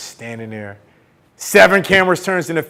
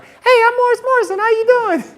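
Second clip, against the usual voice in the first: very high squeaky goofy pickup voice; mock yourself in a silly falsetto; stupid-sounding suitor; 3 dB louder than usual. Suitor as she probably heard him, sounding stupid. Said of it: "I probably sound so stupid".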